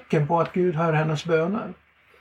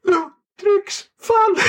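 high, slow, tearful mock faint; man at the car dealer, faint from shock, whimpers high; near fainting; 8 semitones higher, much slower than usual. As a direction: gasp it out high and drawn-out, about to faint. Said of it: "Han höll på att svimma".

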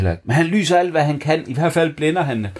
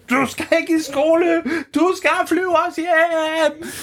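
high insistent voice, last line slow; shaking it awake; slower than usual. Acted. Bamse shaking the bluetit, high insistent. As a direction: firmly wake the sleepy bird. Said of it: "ryster han den og siger".

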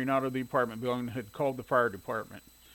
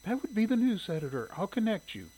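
high, friendly receptionist voice; friendly female voice; 7 semitones higher than usual. A narrator's reading. Receptionist answering a newspaper's phone, friendly. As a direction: answer the phone pleasantly in a raised pitch. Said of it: "The friendly female voice said".

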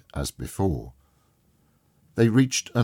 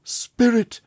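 higher, louder desperate call; cried out; 6 dB louder than usual. A narrator's reading. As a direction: cry out to the spirit in anguish. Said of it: "he cried".

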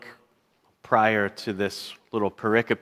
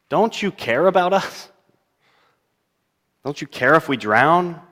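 hurt, higher-pitched angry challenge; mild anger; 7 semitones higher, 5 dB louder than usual. Acted. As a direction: confront him with a touch of anger. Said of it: "anger when they say".

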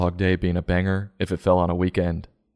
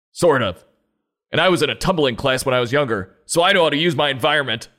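tough-guy groundhog; 6 semitones higher than usual. Acted. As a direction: puff up and warn the crowd off. Groundhog threatening the crowd.

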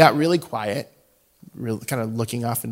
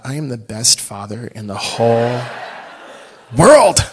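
proud dad; 7 dB louder than usual. Real, not acted. Father bursting with pride at his daughter, laughing.

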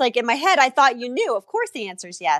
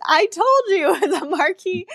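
retelling her words through a laugh, pitched up; laughing quote; 9 semitones higher than usual. Retold or imitated. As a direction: laugh while quoting the friend's told-you-so. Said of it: "the first person to comment".

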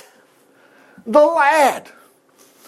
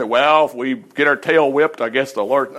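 mock doubtful soldier; 5 semitones lower than usual. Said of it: "Notice they didn't say".

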